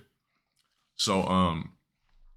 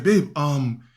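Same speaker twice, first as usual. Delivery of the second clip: teacher voice; 5 semitones higher, 5 dB louder than usual. Retold or imitated. Teacher calls girlfriend.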